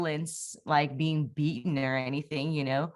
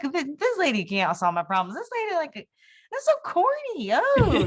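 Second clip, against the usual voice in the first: high, sneering put-down building to a loud scoff; mock the corny lady with disdain; jeering high voice, rising to a louder scoffing oh; scoffing mockery; 11 semitones higher, 5 dB louder than usual. Mocking the corny lady's website.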